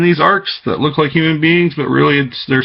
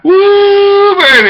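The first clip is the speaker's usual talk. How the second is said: high, loud whoop; excited whoop; 5 dB louder, much slower than usual. Real, not acted. Cheering as he tells of writing the truth, loud high whoop. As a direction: let out a sudden whoop.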